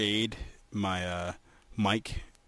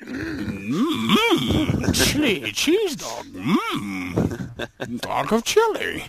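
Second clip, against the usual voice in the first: high, slow goofy craving voice; high goofy cartoon hum; silly cartoon voice; 10 semitones higher, 4 dB louder, much slower than usual. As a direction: moan about chili dogs like a cartoon. Silly food voice.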